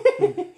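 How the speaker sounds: high, loud burst of laughter. Real, not acted. Laughing along with friends' banter, high loud laugh.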